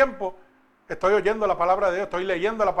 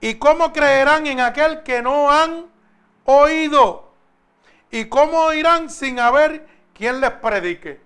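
slow, raised, ringing verse proclamation; emphatic scripture reading; 3 semitones higher, 5 dB louder, slower than usual. Acted. Preacher reads the verse on hearing and believing, slow and emphatic. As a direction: declaim each phrase slowly, higher and louder.

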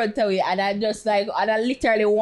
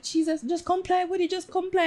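higher-pitched pleading retelling; retold urgent plea; 8 semitones higher, 4 dB quieter than usual.

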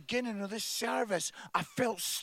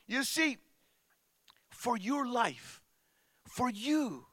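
ordinary talk, nothing marked

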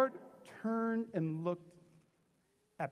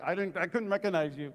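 ordinary delivery

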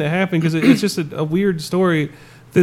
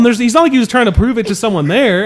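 ordinary talk

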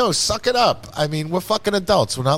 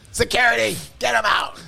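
mock security call; 9 semitones higher than usual.

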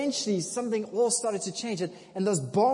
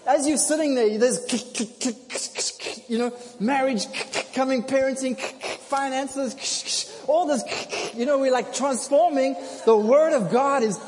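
preaching fervour; 5 semitones higher, 4 dB louder than usual. Real, not acted. Preaching climax, rising fervour.

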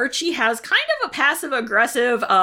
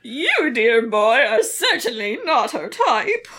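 slow, lightly voiced grande dame; a hint of a haughty grandmother; old lady voice; slower than usual. Voicing Nana Rose at the door.

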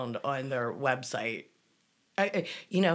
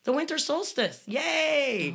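high, sarcastic little cheer; 9 semitones higher, slower than usual. Acted. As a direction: fake celebration, dripping sarcasm. Mock cheer over pagan holiday.

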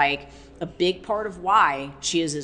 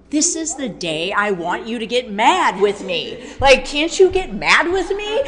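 higher, louder pleading for him to get angry; 5 semitones higher, 7 dB louder than usual. Retold or imitated. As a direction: beg him to share the anger. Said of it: "I'm always just looking at him like".

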